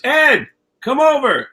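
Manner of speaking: high, loud, slow call; calling over. Retold or imitated. Matty calling Ed over across the alley, loud call. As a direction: call him across the room.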